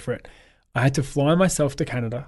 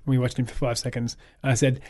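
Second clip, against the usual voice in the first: ordinary delivery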